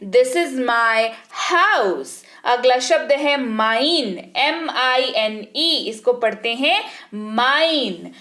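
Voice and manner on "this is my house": this is my house, each word drawn out very slowly; very slow pronunciation. Shown as a demonstration. Teacher carefully modelling English, very slow. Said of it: "आपको उच्चारण वाले शब्द पर ध्यान देना है".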